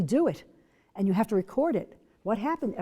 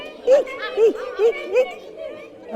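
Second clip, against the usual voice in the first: very high, loud eek; high mouse squeak; 7 dB louder, much slower than usual. Shown as a demonstration. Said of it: "Feel it".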